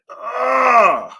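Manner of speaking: loud, high drawn-out "ugh"; exasperated groan. Acted. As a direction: let out a big tempted groan. Acting out being tempted by money.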